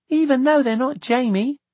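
put-on high-pitched child's afterthought; little girl character. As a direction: add it in a small girl's high voice.

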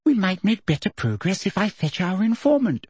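high, smooth, sweet voice; silky. A narrator's reading. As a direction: suggest it silkily to a superior. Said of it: "said Umbridge silkily".

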